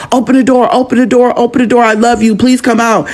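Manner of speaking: high-pitched insistent plea; retold urgent pleading. Aunt banging on bathroom door for her nephew, high urgent pleading. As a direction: imitate a frantic aunt, pitched well up but not shouting.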